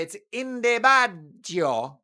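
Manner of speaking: hammed-up Italian accent, slow; playful fake Italian accent; mock Italian. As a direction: drawl it like a jokey Italian. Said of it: "As the Italians would say".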